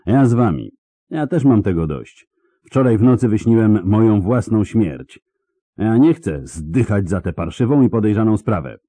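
fed-up outburst. Acted. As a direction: blurt out that you quit. Krill joins the deserters.

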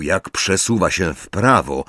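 plain reading voice

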